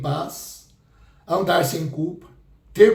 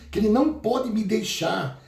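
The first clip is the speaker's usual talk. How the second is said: usual speaking voice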